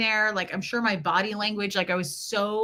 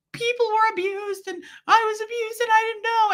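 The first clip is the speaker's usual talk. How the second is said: bawling; 12 semitones higher than usual. Retold or imitated. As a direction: cry it out as the truth breaks. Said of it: "and I was bawling".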